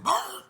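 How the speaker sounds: high, loud yelped bark; mock gunshot bark. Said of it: "It said".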